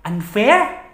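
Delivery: high, rising incredulous question; indignant echo. Acted. Echoing partner's accusation, in indignant disbelief. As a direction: throw the word back as an offended question. Throws partner's word back.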